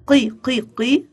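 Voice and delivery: "قي" repeated as a sounded-out syllable; qaf with kasra. Shown as a demonstration. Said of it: "قاف كسرة".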